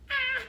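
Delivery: high, loud acted shriek; brief storyteller's scream of fright; short scream. A narrator's reading. Villagers scared by the huge snake, short scream. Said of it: "that they screamed".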